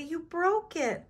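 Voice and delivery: high, slow accusation; acted dismay, pitched high; upset cry. A narrator's reading. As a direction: wail it slowly at the culprit. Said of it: "cried Joey".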